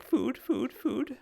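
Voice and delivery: very high, squeaky otter voice; begging otters. Acted. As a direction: beg for food in tiny high yelps. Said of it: "They all look at you like".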